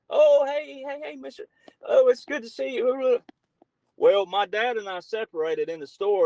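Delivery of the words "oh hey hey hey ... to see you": high, put-on cheery hello to the old boss; imitating a worker sucking up with a smile; fake friendly greeting. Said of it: "he put on a smile".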